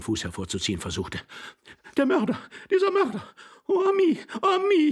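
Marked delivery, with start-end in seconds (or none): 2.0-4.9 very high lamenting lady's voice; high-pitched, hysterical lament; wailing. A narrator's reading. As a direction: wail for the dead pet. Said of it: "jammerte die Dame".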